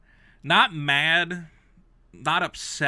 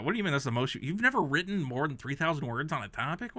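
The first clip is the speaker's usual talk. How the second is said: normal talking voice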